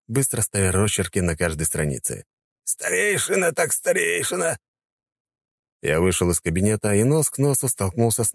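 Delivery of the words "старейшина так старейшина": put-on high resigned old man; old count voice. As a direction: give in with a high sigh.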